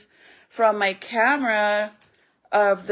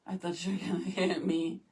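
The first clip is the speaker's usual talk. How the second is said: softer, a hushed joking aside; a hint of pretend fright, softly; quiet mock fear; 3 semitones lower, 8 dB quieter than usual. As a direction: say it low, half to yourself. Joking she feared being hit.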